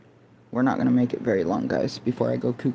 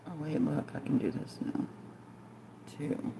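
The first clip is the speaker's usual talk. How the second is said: muttering; 19 dB quieter than usual. Real, not acted. Muttering to herself.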